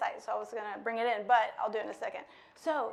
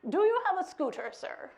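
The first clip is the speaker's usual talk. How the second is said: higher, louder polite ask; polite question; 8 semitones higher, 5 dB louder than usual. Acted. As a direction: put on manners for a stranger. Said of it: "And I said".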